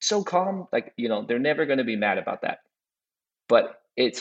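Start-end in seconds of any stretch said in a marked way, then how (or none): 0.0-0.7 high, mock-admiring client voice; mock-impressed client, pitched way up; mock praise. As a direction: sing out the praise in a silly high voice. Imagined client praise, mocking tone.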